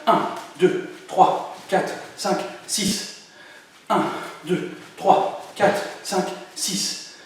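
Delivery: rapid-fire count, almost breathless; very fast counting. Shown as a demonstration. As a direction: rush through the numbers. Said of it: "Encore plus vite". (comptage très rapide)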